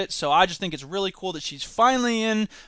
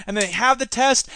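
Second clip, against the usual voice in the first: normal talking voice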